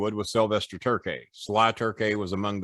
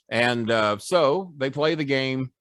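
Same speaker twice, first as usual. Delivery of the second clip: normal talking voice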